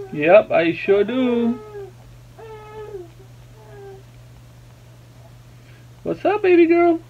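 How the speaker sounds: very high, drawn-out cooing. Real, not acted. Dad soothes crying baby girl, high-pitched baby talk. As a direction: coo soothingly way up high to an infant.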